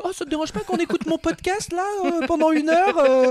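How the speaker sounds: high-pitched imitation of a friend; squeaky mock voice for her words; imitating Marie. Retold words of Marie in the car.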